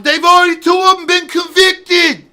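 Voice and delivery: lampoon the TV host in a squeal; mock Deutsch voice. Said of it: "he's up there going".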